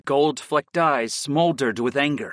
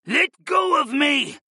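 high, drawn-out growled shout; growling moose; 13 semitones higher, slower than usual. A narrator's reading. Pinned moose shifter to his captor, growling. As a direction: struggle and growl it out, pitched high. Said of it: "the moose growled".

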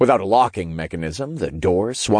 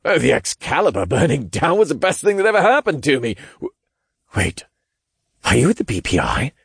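excited rambling; 6 semitones higher, faster than usual. Acted. Zeitman excited then suspicious.

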